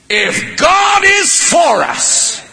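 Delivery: high, slow, soaring proclaim; fervent high climax of the verse; preaching shout. Real, not acted. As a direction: cry it out to the congregation.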